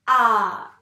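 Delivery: A held long and loud; sounded-out vowel. Shown as a demonstration. Teacher sounding out the second letter, A stretched loud. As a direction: sing out a long, clear A, falling at the end. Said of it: "On va faire le son de ces deux lettres".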